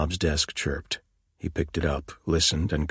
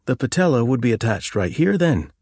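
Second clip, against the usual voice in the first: put-on higher, eager young policeman; young constable voice; 7 semitones higher, 5 dB louder than usual. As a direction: play the keen young constable.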